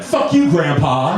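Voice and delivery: high, loud, drawn-out mock jeer; retold insult. Drunk girls refused a drink, mock-scornful. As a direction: imitate a scornful young woman lashing out.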